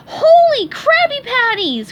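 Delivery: very high, loud, slow alarmed cry; shocked exclamation. Acted. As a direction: blurt it in panic. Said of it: "cried SpongeBob".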